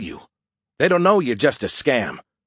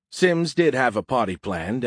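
ordinary delivery, nothing marked

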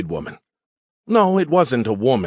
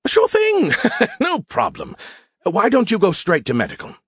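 high, sing-song, covering something; guilty innocence; 11 semitones higher than usual. Acted. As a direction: sound too innocent to trust. Skippy covering up a surprise.

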